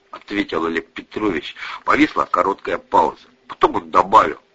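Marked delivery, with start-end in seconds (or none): none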